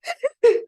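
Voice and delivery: high, loud toddler wail, mimicked; squealing mock toddler sob; imitated toddler whimper. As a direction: copy an overtired toddler's cry. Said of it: "they kinda go".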